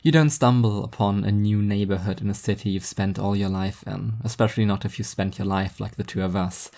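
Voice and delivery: usual speaking voice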